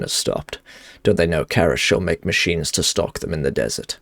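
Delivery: low, even reading of a quest giver's plea; game dialogue read. Acted. Streamer reading a quest NPC's lines to viewers, low and steady. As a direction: read the NPC's warning steadily, a touch solemn.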